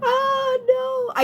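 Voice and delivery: hoher, lachender Aufschrei; crying with laughter. Real, not acted. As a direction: lach dich kaputt über den Chat. Overwhelmed laughing at chat.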